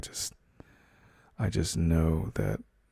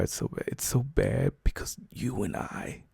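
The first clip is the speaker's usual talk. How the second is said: whisper; 6 semitones higher, 4 dB quieter than usual. Acted. Intimate confession to partner.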